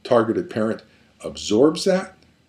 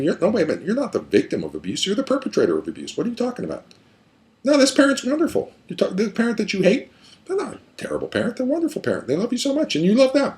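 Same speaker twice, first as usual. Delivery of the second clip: put-on higher, pleading challenge to the child; a higher, urgent therapist voice; therapist challenging child; 10 semitones higher, faster than usual. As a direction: insist the parent is wonderful.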